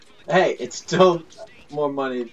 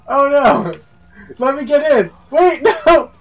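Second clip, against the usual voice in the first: high, loud, laughing panic; panicked shouting; 12 semitones higher, 8 dB louder than usual. Real, not acted. Player begging friend to let him in, panicked shouting. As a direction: yell desperately to be let in.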